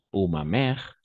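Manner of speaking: usual speaking voice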